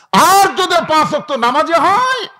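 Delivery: high, loud, ringing call; rousing preaching cry. Real, not acted. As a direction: burst out to rally the congregation. Preacher suddenly raises contrast to congregation. (হঠাৎ উঁচু গলায় জোরালো ডাক)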